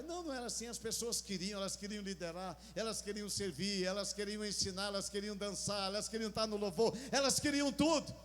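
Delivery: rising from strong to full shouting preach; building sermon. Acted. (pregação crescendo até gritar)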